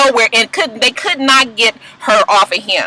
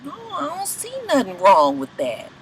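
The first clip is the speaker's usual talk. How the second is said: softer, unbothered shrug of a reply; 8 dB quieter than usual. Retold or imitated. [name] answering the doctor, soft unbothered imitation. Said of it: "He was just like".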